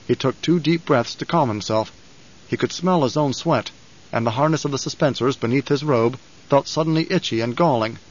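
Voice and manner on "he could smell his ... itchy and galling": ordinary delivery, nothing marked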